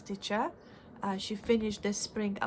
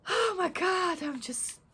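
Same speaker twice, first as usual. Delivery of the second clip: surprised; 10 semitones higher than usual. Real, not acted. Realizes video runs forty minutes.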